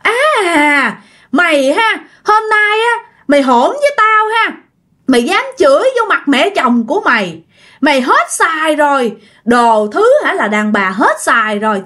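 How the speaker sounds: high-pitched, outraged tirade at the daughter-in-law; acted furious matriarch, pitch soaring; enraged mother-in-law. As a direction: rail at her for daring to answer back. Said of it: "bà mẹ chồng bắt đầu lồng lộng lên".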